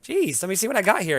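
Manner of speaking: high, loud excited gnome. Acted. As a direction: squeak it out like an eager little gnome. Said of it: "He's like".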